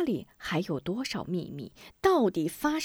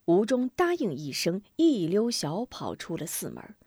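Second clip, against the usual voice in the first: plain reading voice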